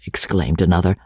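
ordinary delivery

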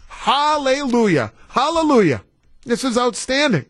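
rejoice like good news just landed; overjoyed. Retold or imitated. Radio host rejoicing over the headline, high and jubilant.

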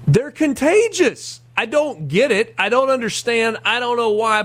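normal talking voice

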